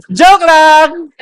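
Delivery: loud, high joking exclaim; laughing joke. Real, not acted. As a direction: toss it off laughing. (pabirong sigaw habang tumatawa)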